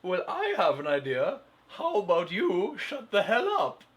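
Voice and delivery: high, mimicking mock cheer turned hostile; hammed-up high-voiced put-down; mocking retort. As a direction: throw the cheery pitch back mockingly. Mocking retort in idea-swap skit.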